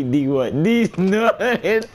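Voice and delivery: punchline rising high into helpless laughter; laughing hard. Real, not acted. Streamer finishing his prank joke, laughing hard. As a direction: crack up on the last word. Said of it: "I'm laughing so hard".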